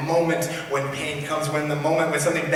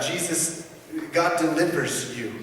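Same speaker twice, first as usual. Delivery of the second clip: rising preacher's voice, higher and louder; preaching at full force; 5 semitones higher, 4 dB louder than usual. Acted. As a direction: preach it out to the congregation. Preaching passage.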